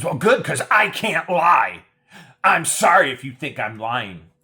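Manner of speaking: high, loud mocking voice; mocking woman. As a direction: play her as indignant and fake. Mocking her reply.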